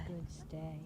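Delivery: quiet reassuring praise for a holding stay; soft calm praise. Real, not acted. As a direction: keep it hushed so the dogs stay settled. Calm praise while dogs hold stay.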